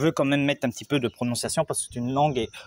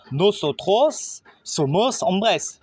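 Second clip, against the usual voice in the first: slow, clear Spanish example for learners; teacher models the Spanish slowly, each word spaced; slow model phrase; 5 semitones higher, much slower than usual. Shown as a demonstration. Teacher shows the masculine form: slow and clear. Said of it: "remplacer os par la terminaison as".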